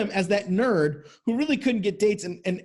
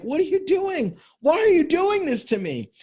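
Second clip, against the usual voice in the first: high, pained cry of disbelief; anguished plea; 8 semitones higher than usual. Retold or imitated. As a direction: plead like a heartbroken man catching his girlfriend. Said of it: "And he's like".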